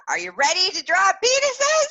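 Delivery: loud, high, excited shout; hyped-up rallying cry. Retold or imitated. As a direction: pump up the crowd with the question. Replays her hyped question.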